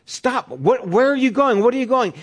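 high, urgent calling out; alarmed cameraman. Retold or imitated. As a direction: imitate a panicked cameraman yelling. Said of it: "you hear the cameraman going".

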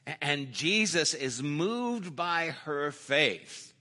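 ordinary talk

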